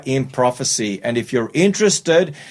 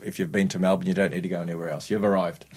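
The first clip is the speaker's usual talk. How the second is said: much softer and fast, tossed off under the breath; quick quiet aside; 8 semitones lower, 9 dB quieter, much faster than usual. Acted. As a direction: rattle it off quietly like a punchline aside. Repeated Melbourne joke as aside.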